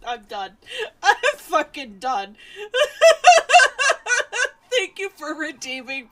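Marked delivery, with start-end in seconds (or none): 0.1-2.4 laughing helplessly. Real, not acted. Laughing in defeat at chat. 2.5-4.5 long squealing laughter; high shrieking laugh. Real, not acted. Cracking up at chat.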